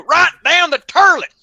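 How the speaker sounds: caller's voice. Shown as a demonstration. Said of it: "That's how we said it".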